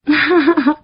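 short high acted chuckle; light story-character laugh; goldfish's laugh. A narrator's reading. Goldfish laughs at the mistaken tadpoles, chuckling. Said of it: "大金鱼笑着说".

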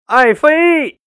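high, drawn-out call to a beloved; acted shout of longing; emotional cry. A narrator's reading. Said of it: "大喊一声".

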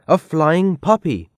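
high, amazed storybook exclamation; excited cry without much volume; mild shout. A narrator's reading. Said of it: "someone shouted".

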